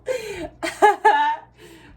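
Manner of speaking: very high loud laugh; big laugh. Real, not acted. Laughing at her own quote, high loud laugh.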